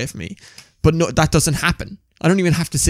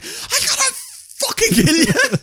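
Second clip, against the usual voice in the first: high, laughing fake threat; laughing mock threat. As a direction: threaten him while cracking up. Jokingly threatening best friend.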